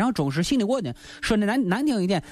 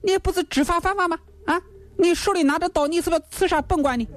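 higher, accusing magistrate's voice; stern official; 9 semitones higher than usual. Acted. Gao Qiu accusing Lin Chong of raiding the hall, raised and stern. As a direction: bear down on him like a judge.

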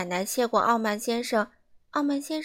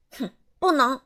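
louder curt huffing no; rude refusal; 2 semitones higher, 6 dB louder than usual. Acted. Mr Rude refusing an old lady the time, rude huff. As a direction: brush her off rudely. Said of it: "傲慢先生粗鲁地说".

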